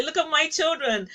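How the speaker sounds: a delighted parent bragging, with a laugh at the start; joyful Daddy God boasting over his children; proud father God. Retold or imitated.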